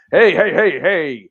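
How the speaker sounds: loud, high gruff pirate. Retold or imitated. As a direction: bark it like an old sea pirate. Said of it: "trying to do my pirate voice".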